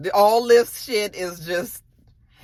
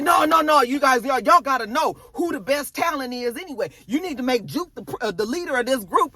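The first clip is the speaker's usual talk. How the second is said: eager dad; 7 semitones higher than usual. Retold or imitated. Marvin pushing Juke at the audition, higher and eager. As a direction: jump in pushing your daughter. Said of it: "Marvin jumps up like".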